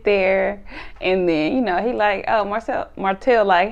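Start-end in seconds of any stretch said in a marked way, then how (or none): none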